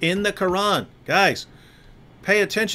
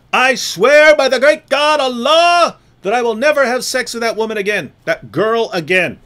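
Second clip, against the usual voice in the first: high, louder hammed-up swearing; mock solemn oath; 5 semitones higher, 6 dB louder than usual. Mocking the prophet swearing to his wives, hammed up. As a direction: declaim the oath with mock grandeur.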